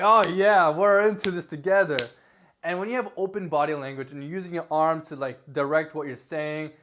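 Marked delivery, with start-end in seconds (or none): 0.0-2.0 louder, eager and bright; upbeat and engaged. Shown as a demonstration. Vlogger showing viewers the engaged on-camera voice, eager and louder. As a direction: pull the viewer in with a loud, eager "we're in this together". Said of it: "More like".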